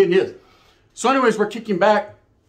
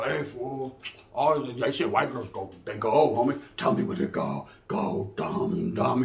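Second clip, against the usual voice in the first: much lower, slow mocking drawl; deep homeboy voice; 6 semitones lower, 5 dB quieter, slower than usual. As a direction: drag the words down low and dumb.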